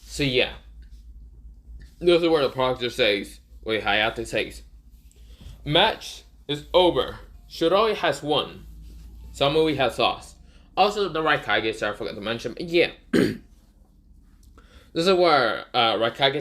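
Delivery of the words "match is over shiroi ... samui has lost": slow, measured referee's call; match announcement. Retold or imitated. Proctor declaring the fight over to everyone, formal announcement. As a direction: announce each verdict slowly and deliberately.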